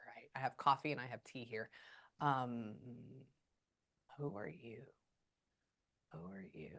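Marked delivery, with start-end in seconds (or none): 6.1-6.7 "Who are you?" much lower and far softer, almost under the breath; hushed repeat. Real, not acted. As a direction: murmur "Who are you?" to yourself, low and soft. Medium asking a spirit again.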